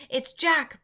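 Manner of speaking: high, loud terrified bellow. A narrator's reading. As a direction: bellow his fear of Jack. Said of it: "bellowed the flesh lump eater".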